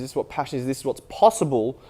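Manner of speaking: normal talking voice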